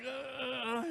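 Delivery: soft bracing ugh; bracing groan. Retold or imitated. Comedian waiting for the weight, soft groan. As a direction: brace for bad news. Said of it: "I'm like".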